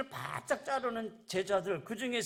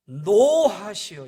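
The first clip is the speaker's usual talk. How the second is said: 노하시어 drawn out slow and much louder; stressed reading; 13 dB louder, much slower than usual. Shown as a demonstration. Pastor shows how 노하시어 should sound. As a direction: read 노하시어 loud and slow with anger. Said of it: "이 말씀 이렇게 읽어야겠죠?".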